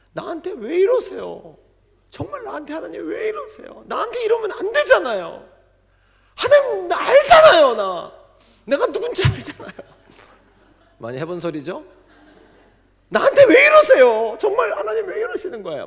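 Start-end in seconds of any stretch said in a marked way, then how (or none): 0.1-9.7 high, loud, whining prayer; whining complaint. Retold or imitated. Deacon whining at God in an acted prayer. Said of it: "이렇게 기도했대요". 13.1-15.9 high, loud, whining prayer; whining complaint. Retold or imitated. Deacon whining at God in an acted prayer. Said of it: "이렇게 기도했대요".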